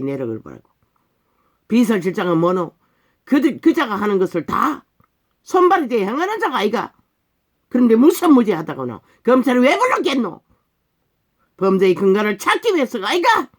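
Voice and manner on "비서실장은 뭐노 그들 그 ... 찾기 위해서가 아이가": heated, accusing pulpit tirade; indignant preaching. Real, not acted. Preacher accusing the followers of sharing the crime, indignant preaching. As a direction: hammer each accusation at the congregation.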